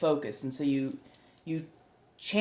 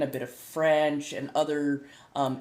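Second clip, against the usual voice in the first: ordinary talk, nothing marked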